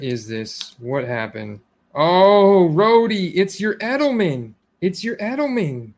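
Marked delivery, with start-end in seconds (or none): 1.9-5.9 loud, high alarmed call to a friend; dismayed outcry. Real, not acted. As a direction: cry out in dismay, voice jumping up.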